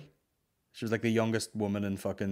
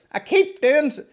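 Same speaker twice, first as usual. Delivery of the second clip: shrill high mock voice, much louder; mocking the woman; 12 dB louder than usual. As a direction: squeal it like the woman in the queue. Woman at clinic, mocked.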